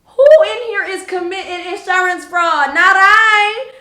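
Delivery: high, very loud theatrical call; hammed-up, high-pitched holler; mock proclamation. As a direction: belt it out like a mock announcement to the room. Acts out calling out her reply.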